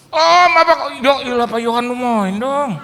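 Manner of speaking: loud, high astonished laughing; shocked guest. Retold or imitated. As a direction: recognize the famous pastor. Guest shocked to see pastor. (kaget keras sambil tertawa)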